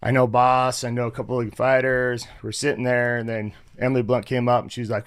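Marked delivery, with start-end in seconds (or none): none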